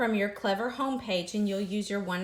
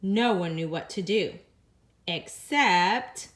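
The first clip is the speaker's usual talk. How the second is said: ordinary delivery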